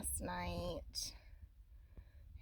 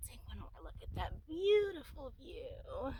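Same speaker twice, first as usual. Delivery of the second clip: soft early-morning whisper; hushed, keeping the voice down; 6 semitones higher, 6 dB quieter than usual. Real, not acted. Hushed looking at view.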